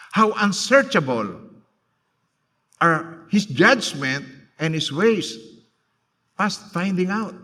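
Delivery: slow, solemn Bible reading; measured preacher reading from the Bible; scripture reading. Acted. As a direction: read the verse slowly and reverently. Reads scripture verse aloud.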